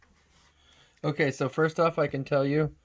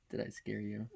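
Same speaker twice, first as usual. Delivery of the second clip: ordinary delivery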